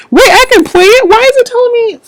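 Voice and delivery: high, loud disbelieving outburst; shrieking at the tablet in disbelief; exasperated surprise. Real, not acted. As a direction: caught off guard by the gadget. Tablet suddenly misbehaves.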